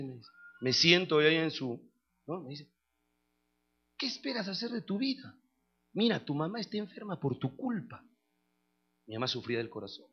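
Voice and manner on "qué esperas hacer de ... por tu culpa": softer, reproachful father's voice; padre que reprocha. Retold or imitated. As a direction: scold your son without shouting. (reproche de padre, con voz contenida)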